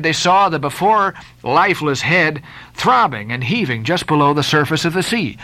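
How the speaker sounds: usual speaking voice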